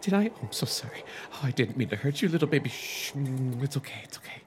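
calm him down gently; soothing whisper. A narrator's reading. Chris comforting the dropped dummy, soothing whisper. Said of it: "she whispered soothingly".

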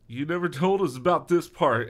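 high, loud complaint; whiny-kid outburst, hammed up; protesting. A narrator's reading. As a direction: protest indignantly. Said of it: "Eric protested to Brendan".

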